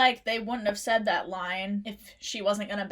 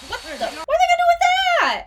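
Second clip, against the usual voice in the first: squeaky, fast and loud in alarm; high alarmed shriek; 10 dB louder, faster than usual. Real, not acted. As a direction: shriek it out in horror. Alarmed reaction to movie scene.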